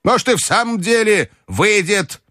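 put-on very high old-lady voice; commanding grandmother. A narrator's reading. Grandmother ordering her companion to bet, high and commanding. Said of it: "приказала мне бабушка".

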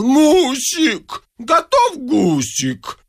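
high, drawn-out hungry yowl; uncontained wail. A narrator's reading. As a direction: cry it out as if unable to hold back. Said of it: "не в силах сдержать себя, завопил". (завопить высоко и протяжно)

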